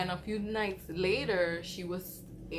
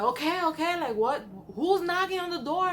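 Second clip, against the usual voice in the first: higher, louder startled yell; startled shout; 9 semitones higher, 5 dB louder than usual. Retold or imitated. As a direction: shout it like he just woke up. Loud uncle woken by knocking.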